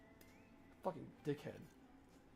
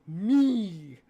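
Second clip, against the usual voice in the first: shouted high and loud; loud exclamation; 4 semitones higher, 12 dB louder than usual. Real, not acted. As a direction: shout it out proudly. Exclaiming self as worst.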